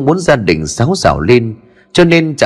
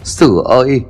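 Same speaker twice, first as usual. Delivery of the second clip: desperate pleading; 4 semitones higher than usual. A narrator's reading. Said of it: "cuống cuồng ôm chặt chân con trai rồi van vỉ".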